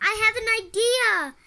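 high, loud, insistent call; trying to be heard. A narrator's reading. May trying again when no one listens, calling loudly. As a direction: raise it over everyone rushing around. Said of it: "She tried again".

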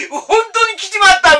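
high, loud, drawn-out disbelief with a laugh; shocked exclamation. Retold or imitated. Driver realising the made-up temple is real, loud disbelief. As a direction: let the disbelief spill out slowly.

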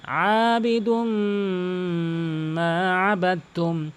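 ওয়ালা আনা drawn out; slow recitation. Acted. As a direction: chant the verse slowly. Recites verses slowly.

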